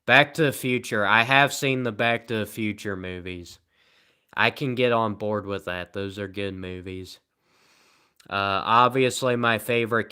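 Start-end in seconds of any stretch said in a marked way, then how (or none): none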